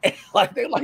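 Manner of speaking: ordinary delivery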